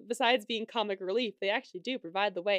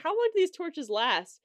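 higher-pitched retold exchange; lightly voiced comic back-and-forth; mock dialogue; 6 semitones higher, 4 dB louder than usual. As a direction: act out the question and answer, pitched up. Acting out funny torch scene.

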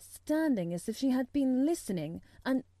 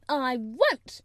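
loud, stubborn refusal; defiant refusal; 3 semitones higher, 6 dB louder than usual. A narrator's reading. Mary refusing Colin's order, defiant. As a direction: refuse point-blank.

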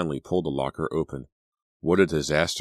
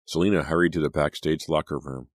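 usual speaking voice

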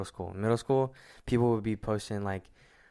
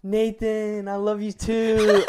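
put-on much higher, slow and dreamy girl voice; mock swooning girl, pitched way up; imagined girlfriend's reply; 12 semitones higher, 8 dB louder, slower than usual. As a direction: gush it back like the girl finally saying yes. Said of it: "then she'll be like".

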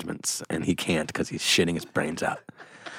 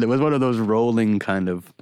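ordinary talk, nothing marked

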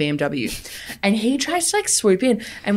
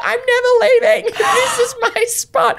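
high, loud mock scream breaking into laughter; laughing scream; 4 dB louder than usual. Retelling how she screamed at a driver, high laughing scream. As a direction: scream it much higher while cracking up.

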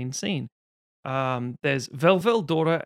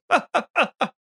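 mock laugh; 9 semitones higher, 4 dB louder than usual. Stabbed man laughs.

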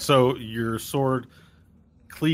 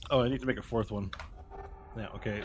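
usual speaking voice

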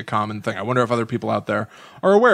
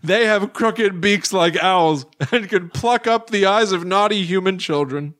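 raised pitch, drawn-out spooky telling; dramatic story reading; 6 semitones higher than usual. A narrator's reading. Reading Hoffmann's wicked Sandman tale to co-host, dramatic storytelling. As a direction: read it like a grim cautionary tale, higher and measured.

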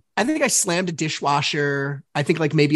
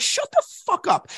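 much higher-pitched mock outburst; exasperated retort. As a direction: replay an angry comeback, voice shooting up. Re-enacting his angry argument.

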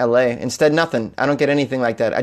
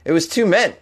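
ordinary talk

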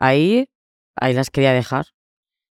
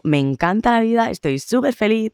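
much higher, sing-song fake happiness; mock the perfect influencer morning; high, sugary parody of forced joy; mock cheery influencer; 8 semitones higher than usual. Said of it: "y diciendo".

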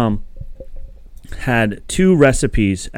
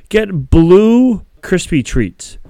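reading recipe; 6 semitones higher, 3 dB louder, much slower than usual. Retold or imitated. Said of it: "it goes".

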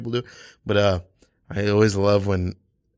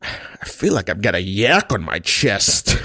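Johnny Carson; 3 semitones lower than usual. Retold or imitated. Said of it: "this is Johnny Carson making the nine one one call".

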